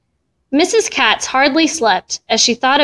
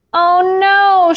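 high, drawn-out cry of dismay; distressed cry; 8 semitones higher, 3 dB louder than usual. A narrator's reading. Missus Katz finds the window open, crying out in dismay. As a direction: wail it in rising alarm, acted. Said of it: "she cried".

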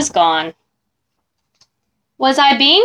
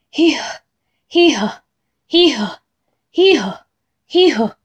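1 panting hee-haw; 3 semitones higher than usual. Acted.

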